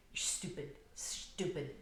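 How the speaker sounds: hushed scornful jabs building to firmer orders; mock scolding. Twelve-year-old with a finger in a five-year-old's face, mock scolding. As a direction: act out a child berating a littler one, held back.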